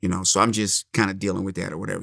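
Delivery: normal talking voice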